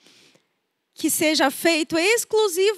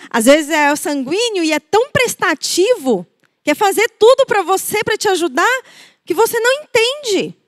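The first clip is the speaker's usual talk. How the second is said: higher, louder gushing enthusiasm; eager and lively; 6 semitones higher, 6 dB louder than usual. Retold or imitated. Preacher describes the eager sanguine person, gushing. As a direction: bubble over like a helpful friend.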